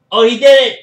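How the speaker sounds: loud, high burst of excitement; excited shout. Real, not acted. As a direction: yell it as the move lands. Finisher lands during live viewing.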